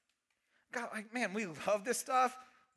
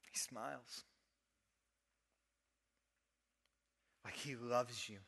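tender hush; 11 semitones lower, 11 dB quieter than usual. Real, not acted. Tender aside about God's love.

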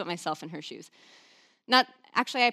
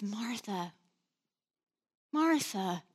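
gentle calling; much slower than usual. Retold or imitated. Jesus gently calling her name twice.

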